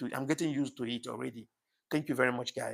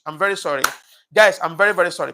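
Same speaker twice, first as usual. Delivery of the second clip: loud, pleading apology to the chat; apology pushed out loud and high; 4 semitones higher, 13 dB louder, faster than usual. Real, not acted. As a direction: burst out with a big sorry, higher and louder. Apologizing emphatically to live chat.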